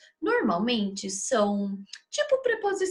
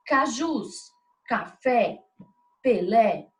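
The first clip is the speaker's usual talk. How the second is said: caJUS, caFÉ, PeLÉ, each final syllable punched; stress on last syllable; slower than usual. Shown as a demonstration. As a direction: say cajus, café, Pelé one by one, leaning on the endings. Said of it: "é a pronunciada com mais força". (cajus, café, Pelé com força na última sílaba)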